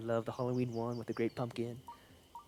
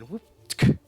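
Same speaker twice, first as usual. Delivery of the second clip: mock fall noise; 5 semitones higher, 16 dB louder than usual. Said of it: "and then going".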